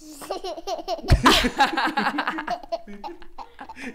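high, loud helpless laugh; real laughing fit; high burst of laughter. Real, not acted. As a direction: crack up again. Laughs again at baby.

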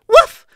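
big loud woof; loud dog bark. Acted. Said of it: "Clifford barked".

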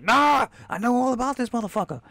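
put-on high, loud old trainer rant; Mickey impression. Trainer Mickey warning Rocky about the challenger, high loud voice. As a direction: rasp it up high like an old coach. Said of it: "Mick's like".

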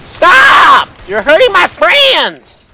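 loud high protest; angry shout. Real, not acted. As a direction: bellow at the attacker. Yelling at enemy in game.

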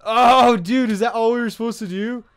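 loud, high, fast disbelief; high fast shocked yell; shocked outburst. Real, not acted. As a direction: blurt it out in amazement. Shocked outburst after running.

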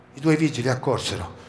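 plain reading voice

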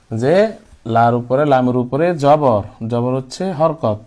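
ordinary talk

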